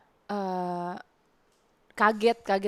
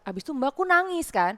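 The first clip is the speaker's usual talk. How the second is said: ordinary talk, nothing marked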